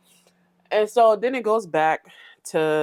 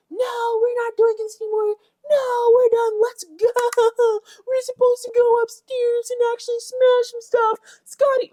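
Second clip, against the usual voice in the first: very high, loud crybaby whine; whiny mock Natalie; 4 dB louder, slower than usual. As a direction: whimper it like a tantrum. Mocking Natalie's whining.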